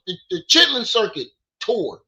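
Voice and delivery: ordinary delivery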